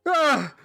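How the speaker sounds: sudden high pained yelp. Acted. Captive yelping as he's pinned to the floor, high and loud. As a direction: yelp as the knee presses in. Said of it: "and he's like".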